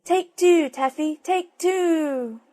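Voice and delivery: high, loud, slow cooing call; silly dove cry. Acted. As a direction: drag out the coo like a dove. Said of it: "kept on saying".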